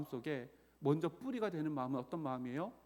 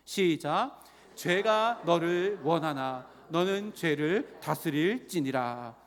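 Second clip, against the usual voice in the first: loud, measured read-aloud for the congregation; leading scripture reading; 7 dB louder, much slower than usual. Real, not acted. Preacher leads congregation through Bible verses, loud deliberate reading. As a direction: declaim the verse slowly and loudly in phrases.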